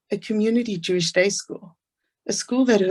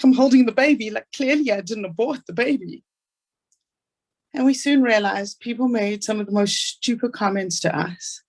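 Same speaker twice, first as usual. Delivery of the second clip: ordinary delivery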